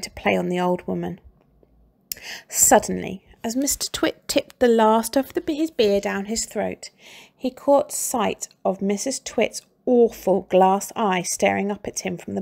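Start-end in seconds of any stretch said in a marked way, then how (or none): none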